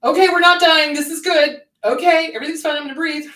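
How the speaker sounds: put-on high, loud reassurance as to a small child; emphatic, high-pitched self-soothing; talking to inner child. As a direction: pitch it up and reassure a little one. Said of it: "Talk to your inner child".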